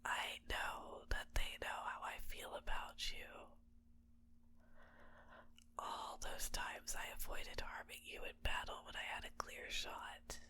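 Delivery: very soft, intimate whisper; whispered confession. Acted. Captor confessing secret feelings to captive, in a hushed whisper. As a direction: confess it under your breath, fully whispered.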